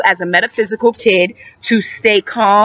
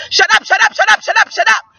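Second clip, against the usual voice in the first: high, rapid repeated shut up; yelling at yourself; 11 semitones higher, faster than usual. Shown as a demonstration. Teacher recaps the calming practice, yelled quickly. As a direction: fire it off as a high-pitched yell. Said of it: "Yell at yourself and say".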